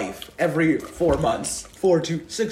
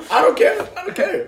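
high laughing mock squeal; much higher, teasing singsong; squeaky taunt; 12 semitones higher, 3 dB louder than usual. Real, not acted. Playful taunt over spilled Fresca.

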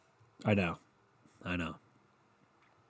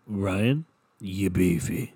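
lower, deliberate "you beefy" after a pause; the approved way; 3 semitones lower, much slower than usual. Shown as a demonstration. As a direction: say "Ryan", pause, then a low, admiring "you beefy". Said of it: "You gotta say it like".

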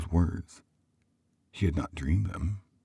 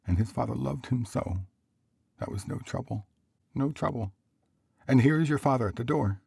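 higher-voiced mother reminiscing; tender mother; 9 semitones higher than usual. Mother intent upon her work, tender high voice. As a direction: remember fondly in a raised mother's voice.

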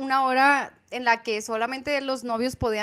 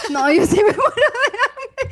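high, loud, slow, laughing joke; laughing self-mockery; 10 semitones higher, 8 dB louder than usual. Real, not acted. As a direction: laugh through the line. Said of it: "joking about singing badly".

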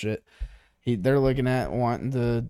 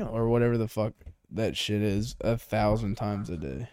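usual speaking voice

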